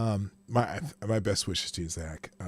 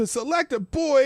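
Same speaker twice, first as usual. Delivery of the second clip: hype-man holler; 11 semitones higher, 5 dB louder than usual. Acted. Host greeting a viewer, high hype-man holler.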